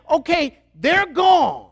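high, loud, slow mock triumph; hammed-up celebratory shout; husbands rejoicing. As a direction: crow it like a relieved dad. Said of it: "We're like".

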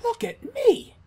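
excited squeal. Real, not acted. Sees own reflection, squeals.

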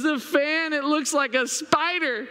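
put-on high, alarmed child's voice; scared little boy. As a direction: imitate a kid frightened by a shadow. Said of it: "And he goes".

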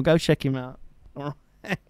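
ordinary delivery

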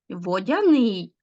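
водяний drawn out slowly, stressed on final НИЙ; stress on the ending. Shown as a demonstration. Teacher showing pupils ending stress, водяНИЙ stressed on the last syllable. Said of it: "наголос падає взагалі на закінчення".

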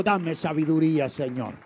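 loud call for the church to repeat; firm call-and-response line; congregation call. Shown as a demonstration. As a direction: say it out so they echo it. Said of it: "Dígale conmigo".

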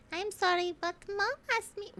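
put-on high little child voice; high-pitched kid reading a letter; Yikes Forever Girl. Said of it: "Now I'm Yikes Forever Girl".

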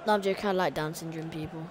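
normal talking voice

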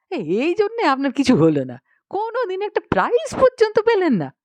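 high-pitched, indignant scolding; annoyed outburst. Acted. Gangaram berating the author over his story's title, annoyed. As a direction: scold in exasperation, voice jumping up. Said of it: "সে চটে গেল, আমার উপর রাগারাগি করতে লাগলো".

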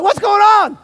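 high, loud startled question; loud, high-pitched retold shock; mock alarm. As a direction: cry out in comic bewilderment. Asks armed police jumping out.

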